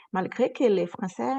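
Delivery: ordinary talk, nothing marked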